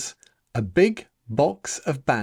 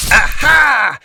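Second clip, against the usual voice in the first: high, loud triumphant shout; boy sure he beat his own trap; triumphant cry; 12 semitones higher, 12 dB louder than usual. Acted. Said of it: "he cried triumphantly".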